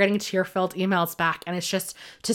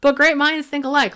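excited reply; 8 semitones higher, 7 dB louder than usual. Retold or imitated. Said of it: "I'm like".

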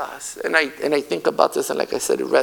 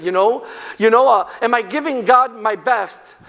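ordinary talk

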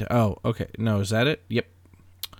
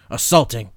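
assaulting punched much louder; emphasis on assaulting; 8 dB louder than usual. Shown as a demonstration. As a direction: hit the word assaulting hard. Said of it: "That was my emphasis".

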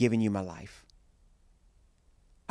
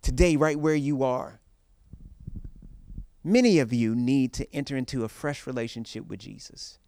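preaching; 4 semitones higher, 4 dB louder than usual. Acted. Preaching at full force.

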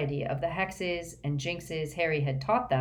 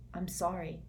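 mumbled; 7 dB quieter than usual. A narrator's reading. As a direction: mumble it awkwardly, under the breath. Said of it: "Harry mumbled".

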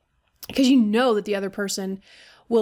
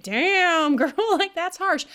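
put-on higher, laughing damn girl at herself; mock self-reproach; 7 semitones higher, slower than usual. Woman chiding herself for being harsh, amused. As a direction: tease yourself for being harsh.

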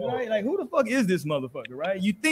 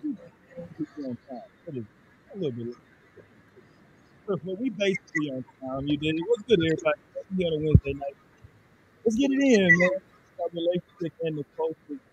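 fuzzy soft voice; 4 dB quieter than usual. Real, not acted. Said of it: "[name], you sound really fuzzy".

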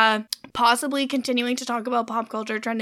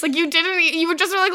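ordinary talk

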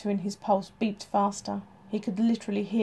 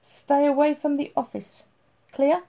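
higher and louder, a sharp final command; firm warning; 6 semitones higher, 6 dB louder than usual. A narrator's reading. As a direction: make it an order, not a request.

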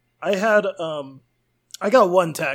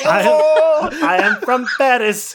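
higher, louder cartoon Russian voice; mock Russian Gustav; 9 semitones higher, 6 dB louder, slower than usual. Gustav telling friends where he's from, in a mock Russian accent. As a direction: proudly announce Paris in a hammed Russian accent. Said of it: "inexplicable Russian accent".

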